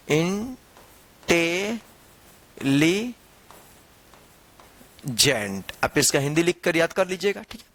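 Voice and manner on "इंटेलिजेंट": इंटेलिजेंट stretched out very slowly, syllable by syllable; very slow model word. Shown as a demonstration. Teacher stretching the hard word for students. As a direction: draw out इंटेलिजेंट so every syllable is clear. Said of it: "आप देख लीजिएगा".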